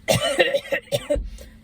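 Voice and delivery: imitated dry coughing fit. Storyteller imitating sleeping friend's sudden fit, dry cough. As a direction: hack drily, a few short coughs in a row. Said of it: "like, a very dry cough".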